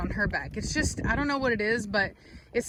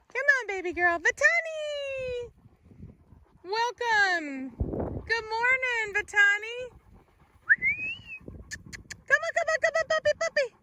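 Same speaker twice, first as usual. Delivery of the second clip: much higher sing-song call; high coaxing call; 13 semitones higher than usual. Real, not acted. Owner greeting and calling her puppies up high.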